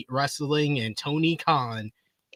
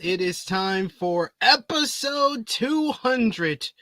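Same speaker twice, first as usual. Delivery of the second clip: slow, high showman intro; hype intro; 6 semitones higher, 4 dB louder, slower than usual. Acted. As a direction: build up the show opening. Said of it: "podcast intro hype".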